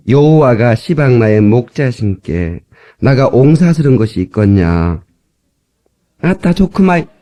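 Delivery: slow Jeolla dialect psalm reading; chant-like, unhurried Jeolla recitation; 전라도 사투리 낭송. Shown as a demonstration. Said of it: "전라도 버전을 제가 따왔습니다". (느릿한 전라도 사투리로 낭송)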